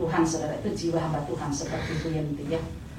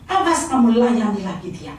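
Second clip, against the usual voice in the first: loud, higher-pitched warning; imitated angry husband; 4 semitones higher, 6 dB louder than usual. Husband forbidding wife to serve again, angry. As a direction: act out a heated telling-off. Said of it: "Suami saya juga emosinya minta ampun".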